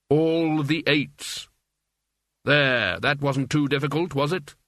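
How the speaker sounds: slow, measured, unhurried doom; Death's voice. Acted. Death calmly taunts the gambler, slow and deliberate. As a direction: let each word fall like a tolling bell. Death speaks to fainted Wah.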